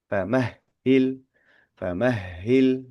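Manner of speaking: famahil slowed, the h held long; drawn-out famahil. Shown as a demonstration. Teacher modelling famahil slowly for students. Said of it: "kita bacanya famahili".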